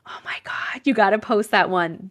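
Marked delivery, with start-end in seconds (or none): 0.1-0.8 hushed whisper to viewers. Real, not acted. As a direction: breathe it out, no voice. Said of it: "whispered aside".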